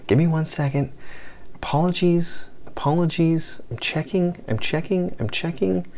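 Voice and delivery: high, soft repeated apologies; high-pitched stalling. Real, not acted. Stalling viewers while checking count.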